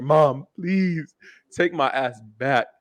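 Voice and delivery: begging. Retold or imitated. Son begging mom to take him back, pleading. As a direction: plead slowly, stretching the words. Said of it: "I begged her".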